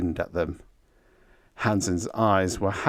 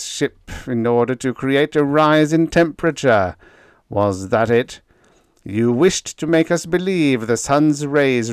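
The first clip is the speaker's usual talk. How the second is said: louder, higher furious interrogation; raging accusation; 5 semitones higher, 6 dB louder than usual. A narrator's reading. Efficiency expert accusing Biggs of sabotage, raging. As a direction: grill him in a rage. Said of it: "raged the efficiency expert".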